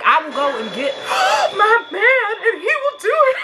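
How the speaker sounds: hammed-up plea for her man to rescue her; laughing imitation of a helpless damsel; mock damsel voice. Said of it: "I would be like".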